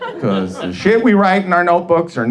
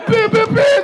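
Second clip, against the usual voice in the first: mock gun noises; 4 dB louder than usual. Imitated gun sounds.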